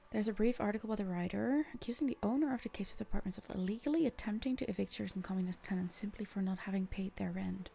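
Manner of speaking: rushed disbelieving reread; fast incredulous reread. Real, not acted. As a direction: race back through it in disbelief. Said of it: "Wait, wait, wait".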